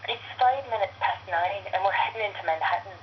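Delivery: chatty Irish subway announcement; Irish train conductor. Acted. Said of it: "let me see if I can get it right".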